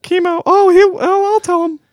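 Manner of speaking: high falsetto woman's voice; man doing a woman's voice. Pretend. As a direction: squeak it out like a girlfriend on the phone. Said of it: "Do you like my girl voice?".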